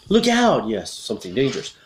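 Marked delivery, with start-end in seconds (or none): 0.1-0.7 high, loud warning; acted cry of alarm; alarmed shout. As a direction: shout a sudden danger warning. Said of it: "you could show alarm".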